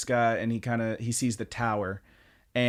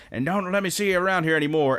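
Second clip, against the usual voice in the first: higher, quick mock warning; retold threat; 7 semitones higher, 3 dB louder, faster than usual. Thug to the beaten brother, mock threatening. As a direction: toss out a bully's parting threat. Acting out thug's parting threat.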